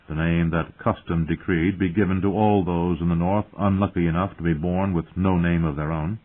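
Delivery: usual speaking voice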